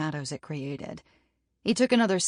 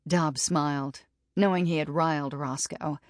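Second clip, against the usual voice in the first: usual speaking voice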